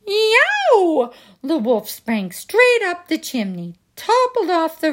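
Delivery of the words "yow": high loud yelp of pain; wolf's pained yowl. Acted. Wolf burnt by the kettle, yelping high.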